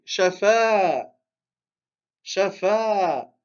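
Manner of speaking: "shafa" with short "sha" and long held "fa"; long second syllable. Shown as a demonstration. Teacher showing the second fatha lengthened, "shafa" with long "fa". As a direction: stretch the final "a" of "shafa". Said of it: "on l'allonge".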